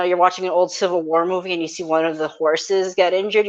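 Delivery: ordinary talk, nothing marked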